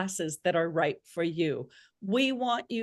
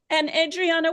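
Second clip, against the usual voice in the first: normal talking voice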